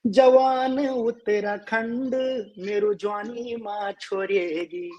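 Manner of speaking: normal talking voice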